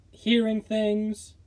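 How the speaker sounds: usual speaking voice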